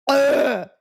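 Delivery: loud high groan of disgust; disgusted ugh. Real, not acted. Disgust after reading.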